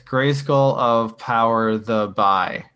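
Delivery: slow, deliberate, each word spaced; slow backwards recital. Retold or imitated. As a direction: read it out slowly word by word. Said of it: "he just says".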